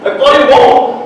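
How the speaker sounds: high, loud stubborn yell; defiant shout. Acted. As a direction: shout down the tears. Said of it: "I shout".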